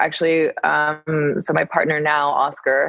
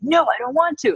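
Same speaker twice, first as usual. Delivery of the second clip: high-pitched mock-petulant refusal; little tantrum; 7 semitones higher than usual. Guest replaying her refusal to her partner, mock tantrum. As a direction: playfully act out a childish refusal. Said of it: "being in my little tantrum".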